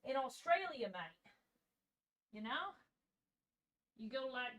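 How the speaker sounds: soft attempt at an Australian accent; amateur Australian accent, very softly. Shown as a demonstration. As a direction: try a quiet, casual Aussie accent. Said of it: "doing a good Australian accent".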